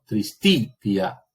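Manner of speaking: "tristitia" with the stress on the middle "ti"; acento en TI. Shown as a demonstration. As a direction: read "tristitia" slowly, leaning on the "ti" before "tia". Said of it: "el acento recae sobre la sílaba anterior". ("tristitia" leído con acento en el "ti" central)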